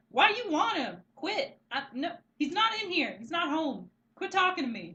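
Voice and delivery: put-on high, loud bratty kid voice; sassy little girl. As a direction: snap it like an annoyed little sister. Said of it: "and she's like".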